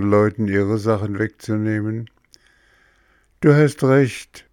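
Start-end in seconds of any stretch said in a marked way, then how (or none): 3.4-4.5 sighing. Acted. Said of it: "seufzte Bengele".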